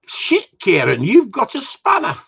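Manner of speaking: jumps up high in pitch; mock amazement. Reading Catherine's comment to Karen, mock amazed. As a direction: read it out with mock shock, soaring upward.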